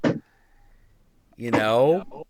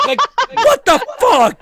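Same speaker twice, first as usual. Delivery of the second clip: high, loud disbelieving yell; outraged yell; 11 dB louder than usual. Real, not acted. Laughing at dad's news, a loud outraged what the fuck.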